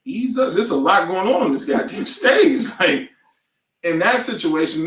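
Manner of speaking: ordinary talk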